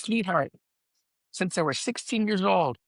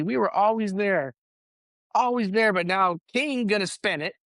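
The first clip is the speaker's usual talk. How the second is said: ordinary talk, nothing marked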